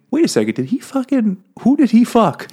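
suddenly realizing and outraged; indignant realization. Retold or imitated. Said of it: "Only later does she think".

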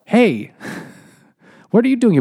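a surprised, laughing hey to a friend; surprised greeting. Retold or imitated. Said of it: "is like".

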